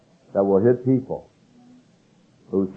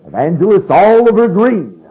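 high, booming, boisterous self-introduction; loud old Southern evangelist; 10 semitones higher, 8 dB louder than usual. Retold or imitated. Evangelist introducing himself at a pastor's conference, loud and boisterous. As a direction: introduce yourself loud and intense, Southern-style. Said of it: "I can remember the way he talked".

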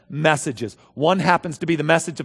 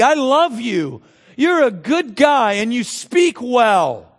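higher, slow, syrupy pat-on-the-back voice; flattering buddy God; 7 semitones higher, 5 dB louder, slower than usual. Retold or imitated. Preacher mocking a God who flatters Moses, higher and syrupy. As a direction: coddle him like an old pal. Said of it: "And God step in and go".

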